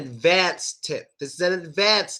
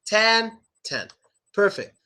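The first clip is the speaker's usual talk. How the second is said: normal talking voice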